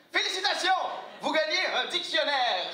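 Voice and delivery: high, loud, excited host announcement; cheesy quiz host proclaiming the prize; game show host. Acted.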